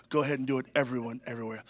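soft, low, half-hearted murmur; mock the quiet churchgoer; timid mumble. Shown as a demonstration. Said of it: "We say".

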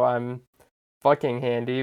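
usual speaking voice

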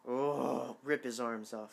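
ordinary talk

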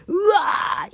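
high mock scream of terror; retelling her dream of a tornado, a high mock yell; retold scream. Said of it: "言うて".

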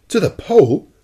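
loud, high-pitched incredulous question; incredulous exclamation. A narrator's reading. Professor exclaims at captain's plan, incredulous. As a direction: burst out in disbelief, pitch leaping up. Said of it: "I exclaimed, unable to repress a gesture of incredulity".

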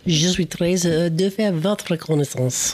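school-French recital of Je suis très heureux; spoken French sentence, classroom style; French phrase. Shown as a demonstration. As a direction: recite remembered French carefully. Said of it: "All I remember is".

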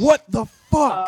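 loud, high, drawn-out disbelief; outraged shout. Real, not acted. Reacting to friend's potato confession, outraged shout. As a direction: yell it slowly in outrage.

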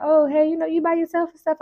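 lightly voiced friendly stranger; chirpy, curious small talk; high girly voice. Girl at the restaurant voiced.

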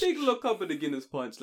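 self-mocking Jamaican impression; bad Jamaican accent. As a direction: echo it in a poor Jamaican accent. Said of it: "that's really bad Jamaican accent".

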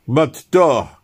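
loud, high, curt demand from a stranger; gruff Russian guard. Acted. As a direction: bark the password question at the newcomer. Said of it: "he said simply".

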